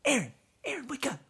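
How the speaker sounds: put-on high, urgent kid voice; excited kid wake-up. Children waking their sleeping stepmom, high and eager. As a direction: rouse her like an eager child.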